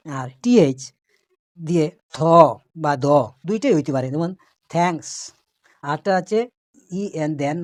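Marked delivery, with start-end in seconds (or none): none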